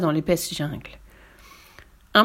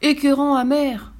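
indignant, high-pitched echo; affronted storybook crocodile; offended; 8 semitones higher, 4 dB louder than usual. A narrator's reading. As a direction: throw the words back, voice raised in pitch. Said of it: "s'offusqua l'énorme crocodile".

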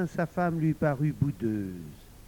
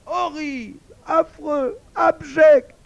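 very high yelping outrage, growing louder; shrieked yelp; 14 dB louder, slower than usual. A narrator's reading. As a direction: yelp each word in horror. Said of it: "glapit grimaçant".

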